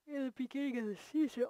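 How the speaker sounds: talking through a yawn; words stretched by a yawn. Real, not acted. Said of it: "I keep yawning".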